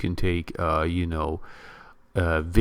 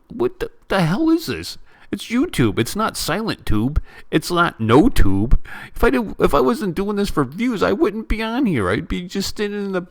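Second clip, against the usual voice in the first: much higher, exasperated outburst; indignant rant; 10 semitones higher, 3 dB louder than usual. Real, not acted. Vlogger ranting at trolls who say he does it for views, pitched high and exasperated. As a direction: fire back at the trolls, voice climbing high.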